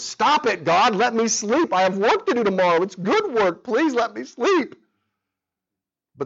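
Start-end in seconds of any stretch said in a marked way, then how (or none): 0.0-4.7 higher, whiny plea to God; pleading complaint. Retold or imitated. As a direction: beg for sleep, half-joking and desperate. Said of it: "What I wanted to say was".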